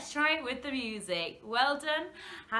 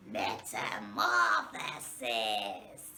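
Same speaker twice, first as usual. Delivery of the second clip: much lower, slow zombie moan; 11 semitones lower, much slower than usual. Shown as a demonstration. Teacher saying the word like a zombie. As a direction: groan it like a shambling zombie. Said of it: "like we are a zombie".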